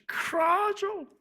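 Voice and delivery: high, long drawn-out animal howl; high wailing cry on one long word; beast-like cry. Shown as a demonstration. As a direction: cry it out like a roaring beast, stretched. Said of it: "짐승이 울부짖는 것과 같은 그런 소리죠".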